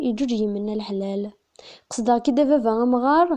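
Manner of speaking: declaimed verse, repeated lines rising in force; poem recitation. Acted. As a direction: recite with swelling emphasis and pauses between lines. (taɣuri n usefru s lǧehd)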